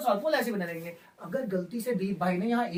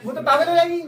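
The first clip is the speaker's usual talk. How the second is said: laughing; 5 semitones higher, 6 dB louder than usual. Real, not acted. Repeats line laughing.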